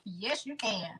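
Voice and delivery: soft, understated YES, YOU CAN; quiet aside. Real, not acted. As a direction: say YES, YOU CAN softly, almost to yourself. First take, answers herself softly.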